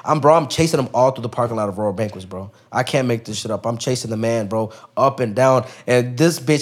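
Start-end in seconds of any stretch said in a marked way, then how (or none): none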